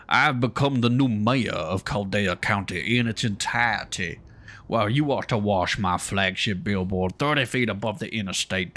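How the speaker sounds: put-on southern drawl for a pompous mayor. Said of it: "like a southern drawl".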